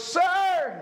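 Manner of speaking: sudden high cry of Sir; beggar calling out. Retold or imitated. Beggar at the gate calls out to Peter, high cry. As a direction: call out high to catch his attention. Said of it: "and the guy goes".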